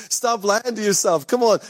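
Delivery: ordinary talk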